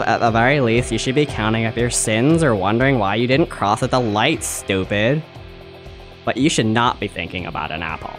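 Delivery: ordinary talk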